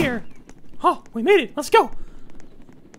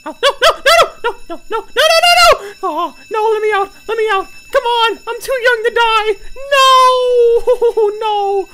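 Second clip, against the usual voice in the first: laughing panic; 5 semitones higher, 7 dB louder than usual. Real, not acted. Trapped, panicking.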